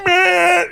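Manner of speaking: long, high, held honk; car horn imitation. Driver honking at woman holding up the line, blaring. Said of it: "put my hand on my horn".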